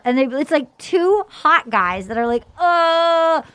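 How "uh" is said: confounded. Retold or imitated. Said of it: "so confounded".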